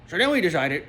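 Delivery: normal talking voice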